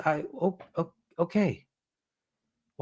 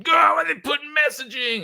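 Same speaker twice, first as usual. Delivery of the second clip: much higher, hammed-up indignant cry; mock offended shout; 11 semitones higher than usual. Streamer mocking viewers upset by messaging, high mock shout. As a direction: play a scandalized viewer, over the top.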